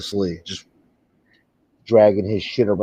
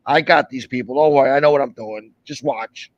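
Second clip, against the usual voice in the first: mimicking Pizza; 3 semitones higher than usual. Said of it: "And he's like".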